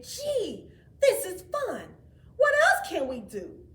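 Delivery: high, loud, playful; cheerful snowman. A narrator's reading. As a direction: bubble with fun. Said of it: "Frosty said".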